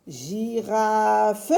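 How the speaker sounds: "Girafe" stretched into separate "Gi-ra-fe"; drawn-out, questioning "Gi-ra-fe"; trying out syllables. Shown as a demonstration. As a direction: test "Gi-raaa-fe" slowly, beat by beat. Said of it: "où est-ce qu'on va placer chaque syllabe".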